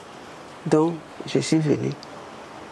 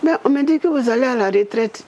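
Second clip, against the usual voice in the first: higher mock surprise; teasing Prime Minister; 8 semitones higher than usual. Woman voicing the Prime Minister's teasing remark, higher. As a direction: tease her about retirement.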